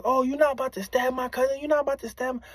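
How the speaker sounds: lightly voiced cousin calling him off; protective cousin. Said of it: "She like".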